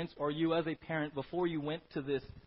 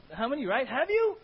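loud, high scolding yell; angry scolding God; 9 semitones higher, 9 dB louder than usual. Acted. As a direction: scold like a fed-up parent. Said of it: "Old Testament God".

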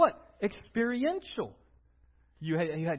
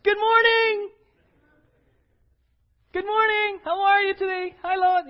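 much higher, louder, beaming good morning; cheery God greeting; 12 semitones higher, 10 dB louder than usual. Pretend. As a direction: greet them like the happiest dad. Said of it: "He was like".

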